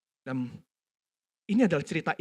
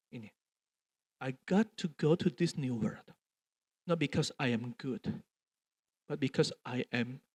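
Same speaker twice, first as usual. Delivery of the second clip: tender daughter; 3 semitones lower, 4 dB quieter than usual. Retold or imitated. Daughter's tender bedtime confession.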